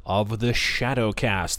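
ordinary talk

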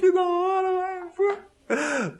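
thrilled shriek of awe at the screen; amazed fan. Retold or imitated. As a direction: gush like a fan who just saw a great fight. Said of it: "Aí você fala".

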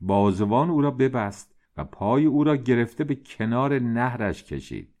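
usual speaking voice

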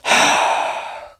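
long, breathy, unvoiced sigh of irritation; mock exasperated sigh; imitated sigh. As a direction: let out an annoyed sigh like the clerk. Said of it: "because he kept sighing".

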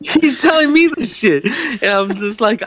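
high, breaking into laughter; laughing. Real, not acted. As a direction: laugh through the line in disbelief. Laughing while retelling.

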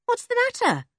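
high, lightly voiced girl; light young girl's voice. A narrator's reading. Joe asks, high character voice.

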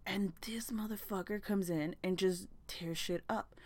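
hissed whisper, all breath; angry whisper. Real, not acted. Vlogger venting at the hornet in a furious whisper. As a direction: spit it out under your breath in disgust. Outraged aside about the hornet.

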